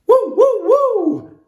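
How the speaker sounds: very high, loud whooping; shrieking woo of delight; joyful yell. A narrator's reading. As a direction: whoop with pure exhilaration at full gallop. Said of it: "I yell".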